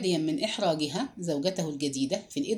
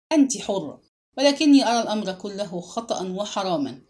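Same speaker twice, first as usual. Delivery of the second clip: ordinary delivery, nothing marked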